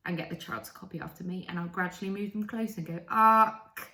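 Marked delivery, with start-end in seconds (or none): none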